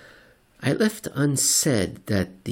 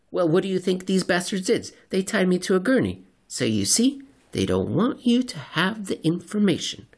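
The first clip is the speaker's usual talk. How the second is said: much higher, indignant conspiracy nut; paranoid crank; 9 semitones higher than usual. Acted. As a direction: spill the secret like they're listening. Seatmate character voiced.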